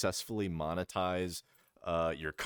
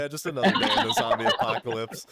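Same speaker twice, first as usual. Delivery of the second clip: loud, quick quote through laughter; mock cocky; 4 semitones higher, 8 dB louder, faster than usual. Friend imitating a player who taunts zombies, mock cocky. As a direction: toss it off brashly, amused. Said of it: "acting like an asshole to all the zombies".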